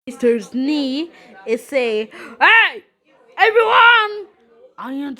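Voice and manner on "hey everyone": loud, high greeting call. Pretend. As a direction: hail the whole crowd. Mister Snee calls everyone.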